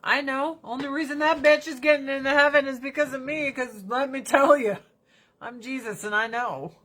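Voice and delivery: a hint of a bragging buddy; Jesus. Speaker voices his pal Jesus bragging about grandma, light cocky voice. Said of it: "He's like".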